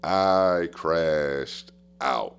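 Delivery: "I crashed out" stretched very slowly, word by word; drawn out for emphasis. Real, not acted. Coach owning up to his meltdown, slow and emphatic. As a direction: draw out each word of "I crashed out".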